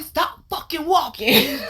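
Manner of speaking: high, slow exasperated command; aggressive. Retold or imitated. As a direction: imitate a friend ordering her to halt, word by word. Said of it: "they still sound aggressive".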